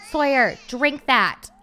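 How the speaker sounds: loud frustrated coaxing; exasperated urging. Real, not acted. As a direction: drag it out, begging her to drink. Urging game sim to drink.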